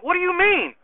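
retelling his own stunned reaction; incredulous. Retold or imitated. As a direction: pitch it way up in shock. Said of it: "I was like".

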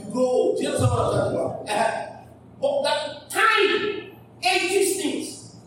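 ordinary talk, nothing marked

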